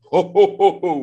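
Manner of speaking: loud hammed-up villain ho ho ho; mock devil laugh. Preacher mocking a silly cartoon devil to the congregation, hammed-up laugh. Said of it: "Dia bilang".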